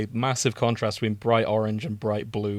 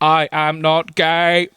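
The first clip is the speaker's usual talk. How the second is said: slow mechanical monotone scream, understated; Dalek; 5 semitones higher, 8 dB louder, slower than usual. Acted. Dalek replies to Amelia's flirting, staccato robotic voice. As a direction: intone each word like a robot alien. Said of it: "he replies in his delicate scream".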